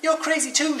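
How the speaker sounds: very high, loud retort; shouting up. A narrator's reading. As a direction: yell it up the tree in protest. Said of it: "shouted Rabbit up the tree".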